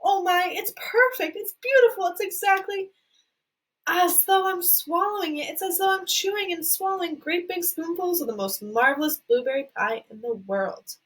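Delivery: rapturous. Acted. Violet rapturous over gum.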